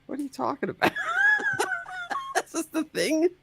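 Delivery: incredulous laughing question. Real, not acted. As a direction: laugh it out in disbelief. Laughs at clip claim.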